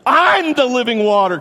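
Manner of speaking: high, loud cheerful call; preacher voicing Jesus calling to the crowd. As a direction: hail them and announce it. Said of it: "Jesus is like".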